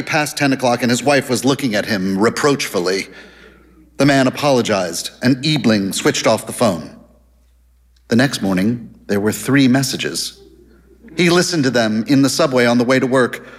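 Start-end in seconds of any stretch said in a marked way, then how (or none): none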